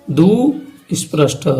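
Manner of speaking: दुस्पृष्ट drawn out slowly, each sound clear; slow repeat. Shown as a demonstration. Teacher repeats the term for students, slow and deliberate. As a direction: spell out दुस्पृष्ट slowly for the class. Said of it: "कौन सा?".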